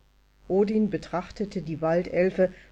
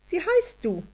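much higher tiny fairy asking wie heißt du; high elf voice; 11 semitones higher than usual. A narrator's reading. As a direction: ask wie heißt du as a prim little elf. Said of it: "Das Wesen sah beleidigt aus".

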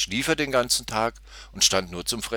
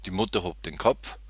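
usual speaking voice

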